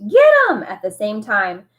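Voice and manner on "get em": high, loud chasing scream. A narrator's reading. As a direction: scream to send the gang after them. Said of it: "someone else screamed".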